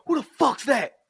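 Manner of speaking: high, sharp imitation of a challenge; mock angry demand. Bishop catches Steel on the phone, mock angry demand.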